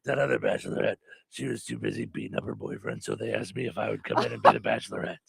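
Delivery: very low, husky celebrity voice; Whoopi Goldberg impression. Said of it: "I'm Whoopi Goldberg".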